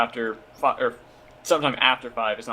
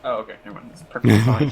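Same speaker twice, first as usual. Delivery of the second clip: sarcastic; 5 dB louder, faster than usual. Acted. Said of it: "Be bitter".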